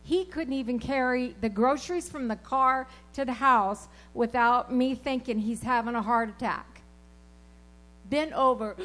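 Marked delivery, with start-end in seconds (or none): none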